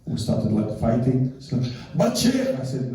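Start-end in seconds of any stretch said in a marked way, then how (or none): 2.0-2.5 high-pitched mock protest; protesting interruption. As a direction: cut in like an objecting wife. Wife interrupts the husband's account.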